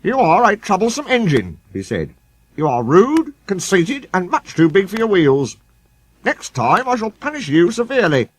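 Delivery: high, stern scolding; severe controller. A narrator's reading. Thin controller scolding Sir Handel that night. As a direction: scold him firmly. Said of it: "was severe with Sir Handel".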